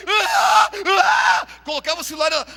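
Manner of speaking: high, loud mock wail of a child losing her phone; imitated child tantrum. Pastor imitating a child whose phone is taken, mock screaming. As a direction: shriek like a spoiled kid, then rush on.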